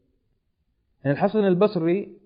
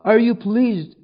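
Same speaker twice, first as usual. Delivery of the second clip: raised, loud accusing question; impassioned challenge, voice lifted; retold reproach; 6 semitones higher, 7 dB louder, slower than usual. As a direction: confront him with indignation. Zainab confronts Umar, retold.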